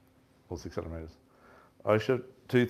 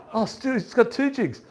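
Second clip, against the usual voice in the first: much higher, louder excited call; 13 semitones higher, 4 dB louder than usual. Retold or imitated. Telling his mate the fish has two jigs, excited. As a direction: point it out in delighted disbelief.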